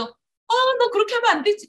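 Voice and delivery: high sing-song scolding; same high tone. Shown as a demonstration. Mother scolding in the same high praising tone. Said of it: "이렇게 똑같은 톤으로".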